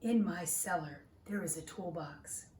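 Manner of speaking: lively, expressive picture-book reading; reading to children. Acted. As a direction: read it like a storybook to little listeners.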